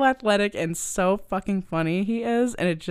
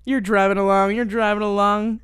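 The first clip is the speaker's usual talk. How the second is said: normal talking voice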